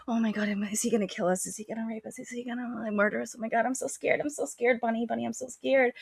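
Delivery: high, small, frightened little voice; mock scared bunnies. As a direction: squeak it softly in pretend terror.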